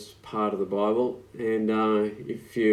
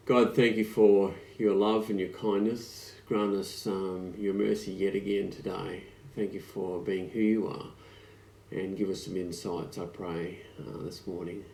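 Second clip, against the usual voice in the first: quiet, softer opening prayer; 4 dB quieter than usual. Real, not acted. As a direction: pray it gently to God.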